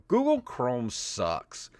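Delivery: ordinary delivery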